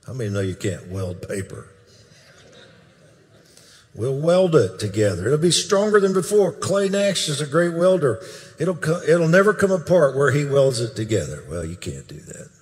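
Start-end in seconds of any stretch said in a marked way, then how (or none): 3.9-11.4 higher and louder, building with conviction; excited proclamation. Retold or imitated. Preacher quoting the dream with rising excitement. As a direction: proclaim it like a promise you believe.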